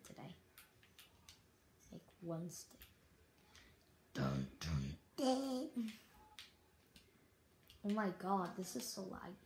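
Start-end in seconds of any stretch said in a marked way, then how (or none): 4.1-5.9 mock-dramatic dun-dun-dun; dramatic sting. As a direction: sing out a suspense sting. Mock dramatic sting after own remark.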